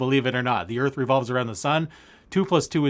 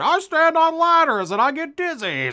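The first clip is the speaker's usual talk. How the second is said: Jimmy Stewart impression; 13 semitones higher, 4 dB louder than usual. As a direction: keep the stammering star voice going, cracking up. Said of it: "Jimmy Stewart".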